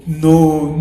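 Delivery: ordinary talk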